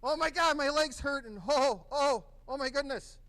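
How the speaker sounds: high, loud cries of a patient in pain; acted pain. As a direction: play the wounded patient, moaning loudly. Instructor acts hurt patient.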